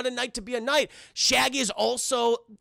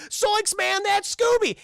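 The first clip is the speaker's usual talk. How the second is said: high, startled cartoon slacker; Shaggy; 10 semitones higher, 4 dB louder, slower than usual. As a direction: exclaim in a high goofy sidekick voice. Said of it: "It's like".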